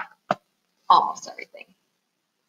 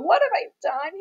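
high, acted wail of regret; despairing mouse cry; storybook lament, pitched up; 11 semitones higher than usual. A narrator's reading. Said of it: "he cried".

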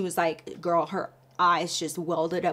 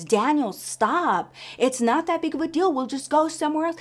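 pleading; 7 semitones higher, 3 dB louder than usual. Retold or imitated. Wife pleading during the fight.

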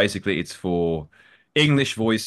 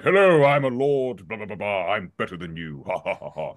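mock villain lord demo; arrogant lord voice; 4 dB louder than usual. Shown as a demonstration. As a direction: sneer down at everyone like a haughty noble. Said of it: "low-speaking character with a voice like this".

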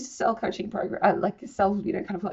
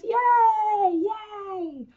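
excited cheer; 13 semitones higher, 3 dB louder than usual. Real, not acted. Excited cheer about the academy.

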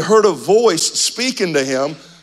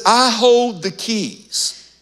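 slow emphatic; 7 semitones higher, much slower than usual. Acted. Voicing Jesus's authority.

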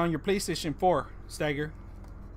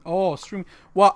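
normal talking voice

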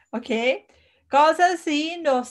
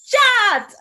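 laughing; 8 semitones higher, 4 dB louder than usual. Real, not acted. Laughing at herself.

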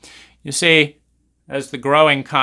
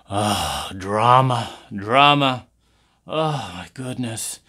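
mocking exasperation; 2 semitones lower, slower than usual.

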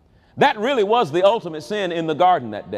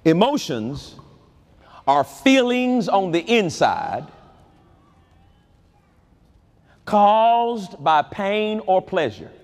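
slowed, each word set apart; slow definition. Shown as a demonstration. Preacher defines emotions for the church, slow and deliberate. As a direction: lay the definition down slowly. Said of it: "let me give you a definition".

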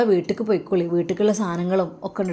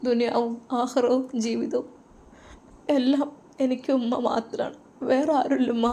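tearful; 5 semitones higher than usual. Acted. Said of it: "കരഞ്ഞു".

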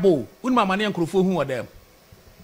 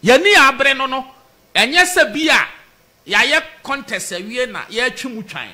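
loud, raised-pitch insistence; impassioned protest; 2 semitones higher, 4 dB louder than usual. Real, not acted. Supporter protesting to the host, loud and impassioned. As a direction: press the grievance hard and loud.

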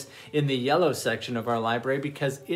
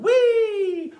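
gleeful whee; 8 dB louder than usual. Acted. Penguin spinning around.

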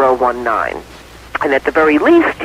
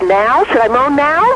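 eager asking; 9 semitones higher, slower than usual. Acted. Wife eagerly asks husband.

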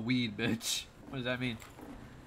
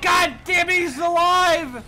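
frustrated shout; 9 semitones higher, 8 dB louder than usual. Real, not acted. Enemy survived, frustrated.

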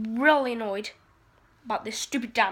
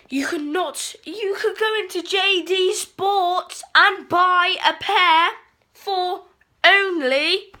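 higher, louder, slowed-down outrage; exasperated rant; 5 semitones higher, 6 dB louder, slower than usual. Real, not acted. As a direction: rant at the absurd price. Ranting about thirty million shoes.

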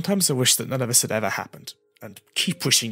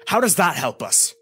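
higher, louder angry demand; indignant, raised voice; heated; 7 semitones higher, 5 dB louder than usual. A narrator's reading. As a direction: snap the question with sudden anger. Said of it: "Link asked heatedly".

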